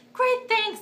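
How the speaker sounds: high, loud sarcastic retort; mock sarcastic thanks. Woman losing her epidural, loud sarcastic thanks. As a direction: throw out thanks high and exaggerated.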